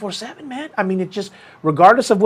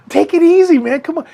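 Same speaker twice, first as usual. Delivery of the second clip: high, louder plea to settle down; urgent pleading; 13 semitones higher, 6 dB louder than usual. Retold or imitated. Friend trying to calm an angry fighter, pleading. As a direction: appeal to him with the voice pushed up.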